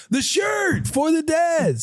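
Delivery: high, slow, drawn-out shout; mock eager shout. Co-host replaying the caller's shout, high and slow. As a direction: stretch it like a fan yelling.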